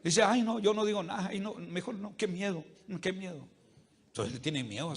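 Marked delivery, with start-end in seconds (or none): 0.2-3.5 fading, much softer, fast frightened murmurs. Acted. Timid person too scared to speak up, fading softer. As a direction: shrink back in fear, voice fading. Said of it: "el miedo".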